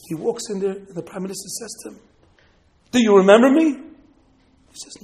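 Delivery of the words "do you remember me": loud, drawn-up challenge; imposing question. Acted. Prime minister confronting the summoned man, commanding. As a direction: ask loudly, as one in authority.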